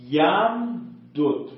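یم دود spelled out slowly, both dals kept apart; unmerged یم دود, drawn out; slow unmerged form. Shown as a demonstration. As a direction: say یم دود syllable by syllable. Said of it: "حالت اول اینه که به فک بخونید".

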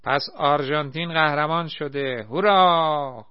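loud, high, drawn-out cheer; joyful shout. A narrator's reading. Naser cheers that Argentina won, loud and high. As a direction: whoop with delight. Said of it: "با خوشحالی فریاد زد".

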